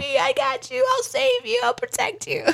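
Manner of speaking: put-on mother retelling how she reassured her screaming kid; hammed-up protective mom. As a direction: play the heroic parent, half joking. Mother comforting child, retold.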